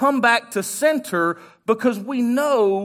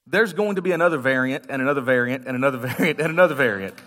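fast, rattled-off list with a laugh; mock weariness; 7 semitones lower, faster than usual. Real, not acted. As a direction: rattle it off like it never ends. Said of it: "mocking endless variants".

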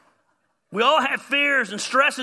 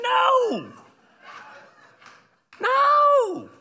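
very high, loud, drawn-out no; hammed-up howl of refusal; shouted refusal; 6 dB louder than usual. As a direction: wail the refusal at the top of your voice. Acted refusal of a scare attraction.

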